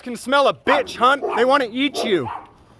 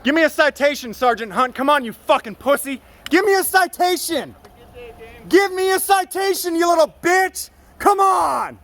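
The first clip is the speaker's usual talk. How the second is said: yelling and cussing; 6 semitones higher than usual. Real, not acted. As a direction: goad him into writing a ticket. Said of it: "I'm yelling and cussing".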